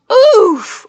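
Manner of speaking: high short hurt oof; Roblox oof. Shown as a demonstration. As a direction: yelp a cartoon oof. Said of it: "the Roblox oof".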